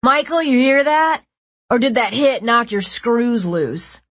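loud, jeering taunt; mocking taunt. A narrator's reading. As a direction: jeer at him loudly. Boy mocking injured leader.

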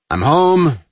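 loud, high call through the house. A narrator's reading. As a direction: call out to the family. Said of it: "Jack announced".

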